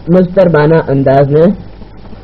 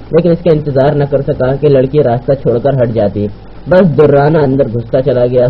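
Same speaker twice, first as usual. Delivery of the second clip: usual speaking voice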